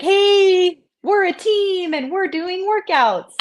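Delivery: high, loud, hammed-up team call; mock team cheer. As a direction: cheer it like an overeager teammate. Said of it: "we were all like".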